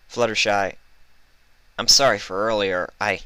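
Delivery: a lightly voiced pleading mare; Vinyl. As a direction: voice the apologetic unicorn.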